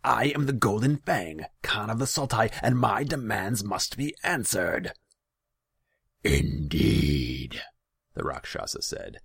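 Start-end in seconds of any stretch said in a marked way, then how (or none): 0.0-4.9 imperious, high, loud proclamation; furious shout. A narrator's reading. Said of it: "he shouted". 6.2-7.6 loud, drawn-out demon rumble; mocking growl. A narrator's reading. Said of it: "showing more mockery than deference".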